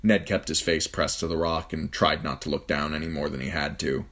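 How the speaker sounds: plain reading voice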